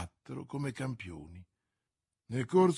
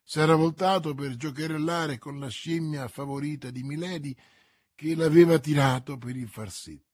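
plain reading voice

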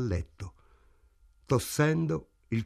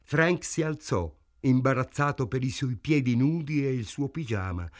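usual speaking voice

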